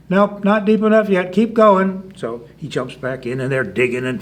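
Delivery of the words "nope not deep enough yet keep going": loud, high, brisk reply; raised storybook rabbit voice; calling orders. Acted. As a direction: call down to the diggers. Rabbit calls orders to diggers.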